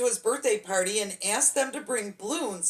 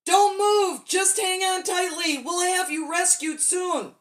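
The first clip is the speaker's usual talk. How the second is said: much higher and louder, calling up into the sky; father's shout; 8 semitones higher, 4 dB louder than usual. A narrator's reading. Father calling up to Billy floating away, urgent shout. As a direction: shout it up to a boy far overhead. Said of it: "His father shouted".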